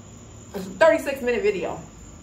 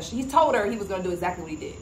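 ordinary talk

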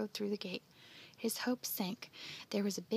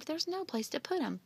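higher-pitched objection; exclaimed protest; 7 semitones higher than usual. A narrator's reading. Andy objecting to Joe's kittens, exclaimed protest. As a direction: exclaim it, at a loss. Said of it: "he exclaimed".

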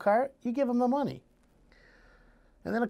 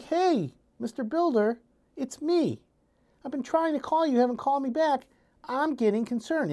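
higher, overly nice voice; sweet, polite plea; 7 semitones higher than usual. Retold or imitated. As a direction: ask nicely, almost too sweet. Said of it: "And you're like".

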